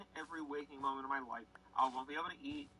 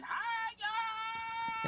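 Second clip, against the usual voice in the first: much higher, bright hello; cheery greeting; 4 dB louder than usual. Retold or imitated. Walking into the bar, he calls a high cheery greeting.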